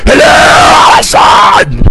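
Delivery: high, drawn-out mock scream; screaming imitation. Host mimicking the end of a song, high drawn-out scream. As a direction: imitate a singer screaming it out slowly. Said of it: "he just starts screaming".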